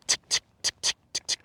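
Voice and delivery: tick, tick said as unvoiced tongue clicks; whispered tick sounds. Shown as a demonstration. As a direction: clip each tick short and breathy. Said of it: "that's the sound that I'm making".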